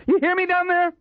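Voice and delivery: high, urgent shout downward; alarmed high-pitched holler; crying out. A narrator's reading. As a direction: call down demanding an answer. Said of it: "Waxy cried".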